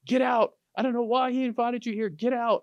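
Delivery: high-pitched imitation of an irate shout; mock yelling. Wife yelling at the realtor fleeing to the door, mock yelling. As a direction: yell it like a wife throwing out an intruder. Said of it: "she's still yelling".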